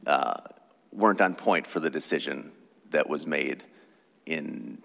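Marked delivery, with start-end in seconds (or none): none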